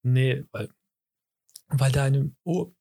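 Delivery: choking mid-sentence on something swallowed wrong; words catching in a choked throat. Real, not acted. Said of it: "Weil ich hab mich verschluckt".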